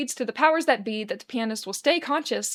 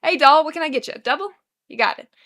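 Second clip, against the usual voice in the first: higher, loud and chipper; bright bartender greeting; 5 semitones higher, 8 dB louder than usual. Acted. Bartender greeting a customer at a busy bar, cheerful and loud. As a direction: sell it like a friendly barkeep.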